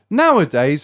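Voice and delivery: ordinary delivery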